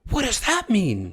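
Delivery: high, slow, exaggerated puzzlement; baffled. Friend answering the startling news, baffled. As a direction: imitate a friend utterly lost by the news.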